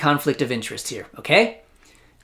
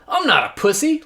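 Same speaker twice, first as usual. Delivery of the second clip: lightly voiced tough guy, higher; mocking the mayor; 9 semitones higher than usual. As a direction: parody a macho boast. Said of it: "look at the other players and go".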